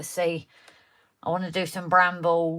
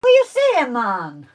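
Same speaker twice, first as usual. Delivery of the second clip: hammed-up Jamaican accent, much higher and louder; mock Jamaican accent; 5 semitones higher, 10 dB louder than usual. Answering her friend's teasing in a loud mock Jamaican accent. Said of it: "any accents very well at all".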